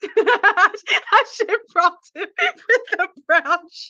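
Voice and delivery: words squeezed out through real laughter; can barely talk for laughing; laughing hard. Real, not acted. Laughing at her own brown shoes joke, breaking up. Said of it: "I'm really crying over here".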